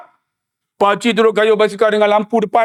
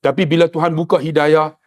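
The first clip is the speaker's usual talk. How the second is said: earnest sermon; 6 semitones lower than usual. Acted. Serious closing sermon.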